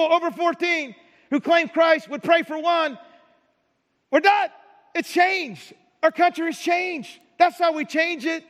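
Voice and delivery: fervent preacher shouting at the congregation; preaching at full force. Real, not acted. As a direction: drive the point home to the congregation.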